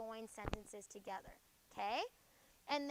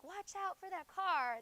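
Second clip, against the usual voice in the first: higher-pitched mock warning; strong command; 6 semitones higher, 4 dB louder than usual. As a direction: call out an urgent warning, lightly acted. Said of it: "like, strong command".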